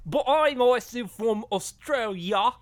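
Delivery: put-on slow, high, loud Australian accent; exaggerated Australian accent; Nicole Kidman. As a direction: protest in a drawn-out Aussie whine. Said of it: "I'm Nicole Kidman".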